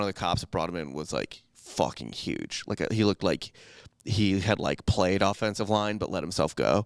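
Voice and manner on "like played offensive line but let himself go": usual speaking voice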